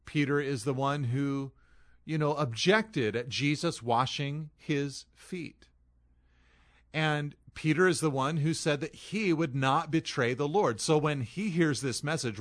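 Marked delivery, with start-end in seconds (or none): none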